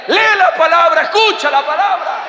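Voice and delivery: high, loud shouted order; shouted command. Acted. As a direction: yell it at the one beside you. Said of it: "dígale".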